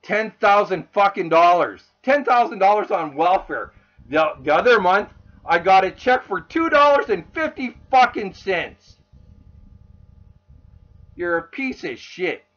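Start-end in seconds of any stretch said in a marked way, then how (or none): 0.0-9.0 loud, real outrage; fed up and raising the voice; indignant rant. Real, not acted. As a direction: hit the sums with angry disbelief. Angry rant about money taken.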